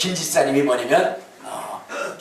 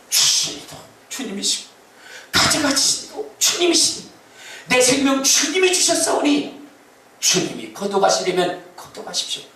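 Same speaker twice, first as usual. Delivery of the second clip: Job's confession; 6 semitones higher than usual. Retold or imitated. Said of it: "욥처럼".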